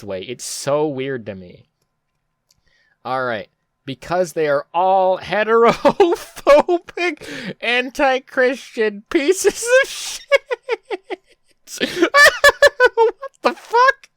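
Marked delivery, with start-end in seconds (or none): 3.9-10.2 reading through high-pitched laughter; can barely get the words out for laughing; cracking up mid-read, voice going high. Real, not acted. Reading absurd comment, laughing. 11.8-14.1 high, loud, laughing disbelief; squealing with laughter; shrieking laugh. Real, not acted. Shocked laughter at comment.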